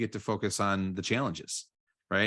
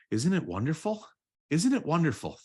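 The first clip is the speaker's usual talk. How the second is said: higher, lifted and delighted, growing brighter; rising joy; 7 semitones higher than usual. Shown as a demonstration. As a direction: let the gratitude lift you up, higher each time. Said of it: "And then I'm just gonna say".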